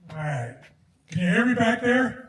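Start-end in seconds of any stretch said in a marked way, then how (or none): 1.1-2.3 raised voice to reach the back; calling out. Real, not acted. Speaker calls to the back rows, loud. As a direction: call out to people at the back.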